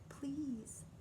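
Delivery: soft whispered please. A narrator's reading. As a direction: breathe the word please gently. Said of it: "She finally said".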